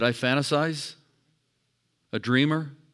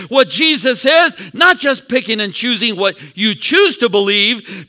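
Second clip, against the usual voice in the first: high, loud revival cry; preaching at full force; 9 semitones higher, 12 dB louder than usual. Acted. Pastor calling his church to praise, shouting. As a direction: lift the room with every phrase. Preacher expounds Hebrews loudly.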